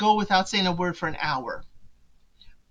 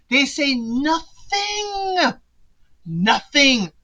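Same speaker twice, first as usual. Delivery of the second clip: "nothing" drawn out very slowly, higher; stress on "nothing"; 6 semitones higher, 4 dB louder, much slower than usual. Real, not acted. Telling viewers about the silent retreat, stretching "nothing". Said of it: "emphasising the silence retreat".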